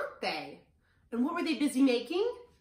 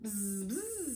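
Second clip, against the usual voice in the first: two soft bee buzzes; soft storybook bee noise; 6 dB quieter than usual. A narrator's reading. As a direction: buzz quietly like a bee. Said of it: "says Bee".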